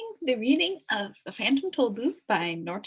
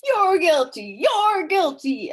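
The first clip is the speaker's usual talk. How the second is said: high, loud, slowing shout; accusing shout; 9 semitones higher, 7 dB louder, slower than usual. Acted. Officer Shrift shouting at everyone he passes, accusing. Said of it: "stopping long enough only to shout".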